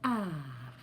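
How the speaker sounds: usual speaking voice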